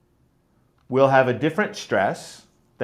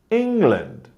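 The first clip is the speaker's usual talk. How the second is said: "England" with ENG strong and land weak; first-syllable stress; 5 semitones higher than usual. Shown as a demonstration. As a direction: hit ENG, let land fall away. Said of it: "use this kind of stress pattern".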